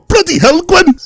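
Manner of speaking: parents out-Welshing each other; exaggerated Welsh outburst; mock Welsh accent. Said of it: "trying to do the Welsh".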